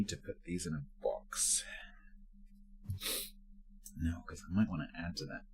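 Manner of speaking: whispered to yourself; whispering. Real, not acted. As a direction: whisper it while packing. Muttering to self while packing.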